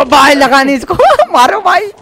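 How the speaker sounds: loud, high-pitched yell to a teammate; high, urgent gamer's outburst; excited shout. Real, not acted. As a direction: cry out at a missed shot, urging him on. Missed shot in live game.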